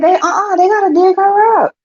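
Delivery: retelling her demand to dig up the body; insistent outburst. Retold or imitated. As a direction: rise up indignant, pitched high. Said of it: "I said".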